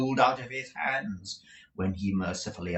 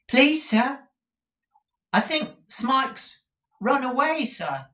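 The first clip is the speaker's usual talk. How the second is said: put-on high, timid small boy's voice; shrill little boy; 7 dB louder, slower than usual. A small pupil timidly answering the schoolmaster in a high voice. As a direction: pipe up like a frightened child. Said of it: "one shrill voice was heard to say".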